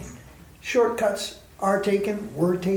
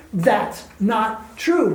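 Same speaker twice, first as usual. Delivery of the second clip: slow firm denial; much slower than usual. Real, not acted.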